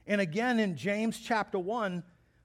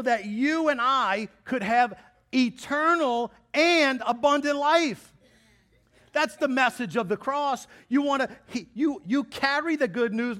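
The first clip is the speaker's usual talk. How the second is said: builds from steady to loud, high preaching; rising fervor, pushing them to go tell others; 4 semitones higher, 5 dB louder than usual. Acted. Pastor to congregation, building to full-force preaching.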